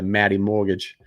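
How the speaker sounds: imitating a buddy's Boston accent; light Boston accent with a dropped R; hint at a Boston accent. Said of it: "said it with such a cool accent".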